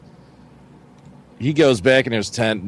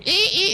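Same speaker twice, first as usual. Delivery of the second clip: very high short squeaks; imitated raccoon squeals. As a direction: mimic small critters squealing. Imitates animals at the tent.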